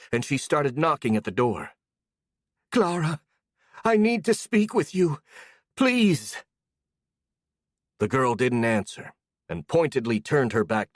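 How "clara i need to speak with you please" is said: high desperate pleading. A narrator's reading. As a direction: beg through the door. Desperate woman knocking at door.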